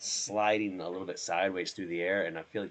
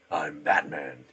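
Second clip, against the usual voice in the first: Batman; 2 semitones lower, 5 dB louder, slower than usual. Acted. Man showing off his deepened voice, Batman growl. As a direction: growl it like a masked vigilante. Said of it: "Batman voice".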